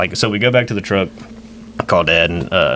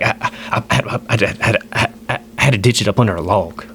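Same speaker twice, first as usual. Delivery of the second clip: imitated halting stutter before confessing; stammering. Uncle admitting where his stuff is, stammering. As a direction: get stuck on the first word, then let it out. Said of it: "he, like, stutters like that".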